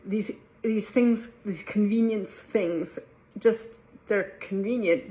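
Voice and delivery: ordinary talk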